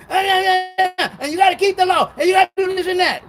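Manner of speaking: fast high mock lecture; mocking purple shirt dudes. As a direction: rattle it off like a smug preacher.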